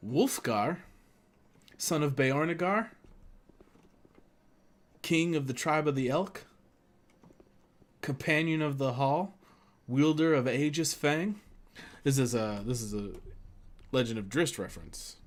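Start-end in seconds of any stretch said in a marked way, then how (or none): 0.1-11.4 higher, mock-grand roll call of titles; heroic proclamation. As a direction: announce each title like a herald, pausing between. Reciting a hero's grand titles.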